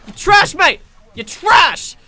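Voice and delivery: loud mocking yell at the enemy; taunting shout. Real, not acted. Mocking enemy hiding in trash.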